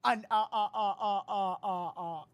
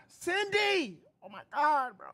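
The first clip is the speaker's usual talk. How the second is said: loud, high, thrilled call of a name; excited greeting; 5 semitones higher, 6 dB louder than usual. Real, not acted. Streamer thrilled to see a viewer's donation, loud excited call. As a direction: shout out the name with delight.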